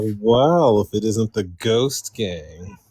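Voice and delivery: slow, drawn-out playful well well well; mock villain greeting. Guest teasingly greets the host's Ghost Gang, drawn out. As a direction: greet them like a sly villain, slowly.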